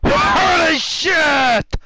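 very high, drawn-out loud cry; shouted outburst. Real, not acted. Reacting to a sudden shock, shouted outburst. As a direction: scream it out long and high.

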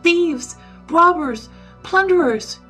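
high, loud cries of alarm; full-might shout. A narrator's reading. Robbed man springs on the student, shouting. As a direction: yell each accusation with all your might. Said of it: "sprang upon him and shouted".

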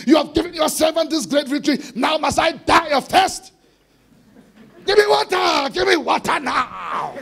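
desperate shouted prayer. Acted. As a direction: cry out to God as if dying of thirst. Preacher cries out Samson's thirsty prayer.

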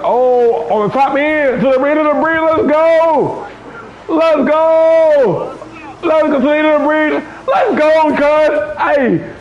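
yelling hype at a race win; too loud. Real, not acted. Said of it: "too loud, man".

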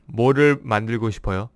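뭐를 만들고 싶어요 rattled off fast; fast Korean retake. Shown as a demonstration. Teacher repeating a Korean phrase for viewers, fast. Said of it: "'cause I'm speaking fast".

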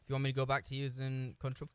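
usual speaking voice

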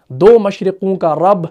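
ordinary talk

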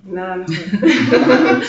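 slow, laughing reply; chuckling through it. Real, not acted. Laughs at the sister remark.

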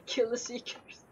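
hushed scheming whisper. Real, not acted. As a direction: whisper it like a plot. Scheming against seekers.

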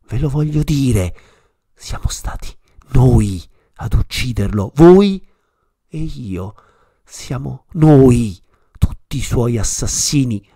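loud accusing shout, voi hit hardest. A narrator's reading. Madman accusing the crowd of killing God, shouting. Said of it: "gridò".

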